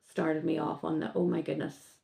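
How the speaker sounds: normal talking voice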